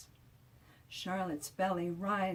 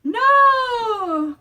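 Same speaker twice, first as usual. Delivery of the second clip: put-on a high, loud, desperate little-girl cry; Charlotte's cry; 17 dB louder than usual. A narrator's reading. Charlotte crying out at her noisy world, loud and high. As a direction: shout it out, fed up with noise. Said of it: "cries Charlotte".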